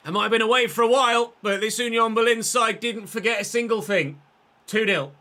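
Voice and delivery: loud, high goal commentary; excited match commentary. Acted. Commentator calls a striker's goal to viewers, excited play-by-play. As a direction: call the goal in full voice, building to a peak.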